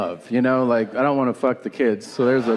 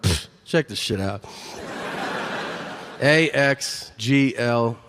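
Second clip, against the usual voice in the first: slow, cocky letter-by-letter readout; arrogant; 4 semitones lower, slower than usual. Retold or imitated. Driver taking an eye test at the DMV, cocky and arrogant. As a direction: show off with mock confidence, spacing out each letter. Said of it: "I was still arrogant about it".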